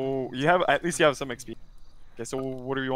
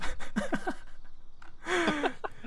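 high giggle; 5 dB quieter than usual. Real, not acted. Laughing at spell names.

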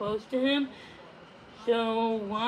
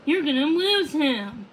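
mild mock yell; 4 semitones higher, 5 dB louder than usual. A narrator's reading. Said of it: "Puffin yelled".

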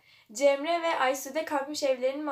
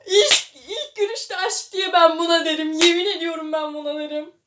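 loud, higher, through laughter; laughing rant; 4 semitones higher, 9 dB louder than usual. Real, not acted. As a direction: laugh while declaring it. Laughing at love-at-first-sight. (gülerek yüksek sesle söylenme)

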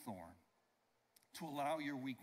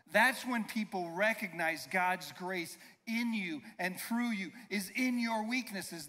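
ordinary talk

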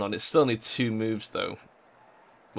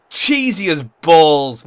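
slow, much louder angry complaint; angry outburst; 4 semitones higher, 11 dB louder, much slower than usual. Real, not acted. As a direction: bellow it in disgust. Furious at cheap goal.

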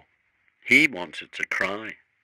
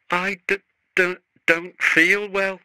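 high, stammering fake-sick whine; quavering; 11 semitones higher than usual. A narrator's reading. Sir Handel dodges the controller, high stammering quaver. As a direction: stutter it high like you're faking illness. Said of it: "quavered Sir Handel".

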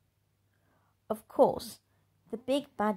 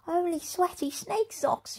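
put-on much higher, cheeky rough girl; a snotty bully sneering; big bad girl; 13 semitones higher than usual. Girl thinks rudely.

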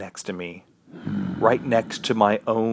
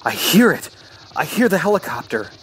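higher, excited cry; urgent excitement; 8 semitones higher, 4 dB louder than usual. A narrator's reading. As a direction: exclaim it with sudden hope. Hears rescue helicopter.

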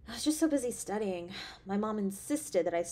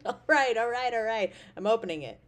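laughing; 7 dB louder than usual. Acted. Laughing, giving in.

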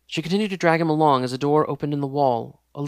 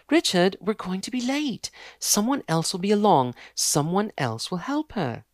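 higher, insistent hurry; impatient fiancée; 6 semitones higher than usual. Acted. Fiancée pressures Richard to leave, impatient. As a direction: push him to leave.

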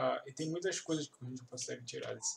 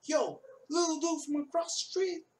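high and loud, "Yo, Lil Dude" called across the street; high shouted street greeting; 13 semitones higher, 5 dB louder than usual. Retold or imitated. Teacher imitates the character hailing a kid, high and loud. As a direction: hail the kid from across the road. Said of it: "character greeting on the street".

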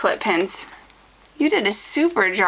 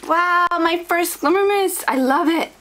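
thrilled; 8 semitones higher than usual. Real, not acted. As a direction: gush over the first spray bottle. First Glimmer Mist in her hands.

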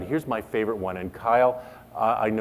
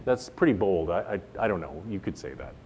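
ordinary talk

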